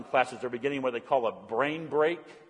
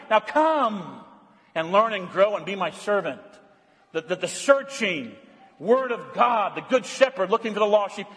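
raised, ringing sermon voice; fervent preaching; 7 semitones higher, 3 dB louder than usual. Acted. Pastor preaching loudly to his flock, fervent. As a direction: preach it out loud to the congregation.

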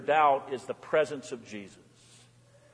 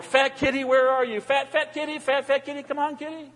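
high, sing-out pet call without real volume; re-enacted calling out; 9 semitones higher than usual. Pastor calling out his door for the cat, mock yell. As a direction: coax a lost cat from the door in raised pitch. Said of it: "Yelling out my door".

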